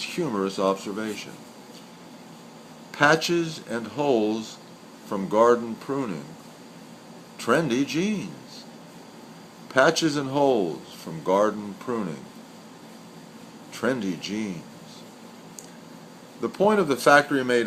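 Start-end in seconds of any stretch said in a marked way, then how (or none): 2.9-14.6 slow, measured haiku reading with long pauses; poem recital. Acted. Reading a senryu aloud to listeners, slow measured recital. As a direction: let each line of the poem hang before the next.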